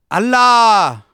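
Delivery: high, forceful yell falling at the end; loud shout. A narrator's reading. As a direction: shout it out obediently at high volume. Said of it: "आता मीही जोरात ओरडलो".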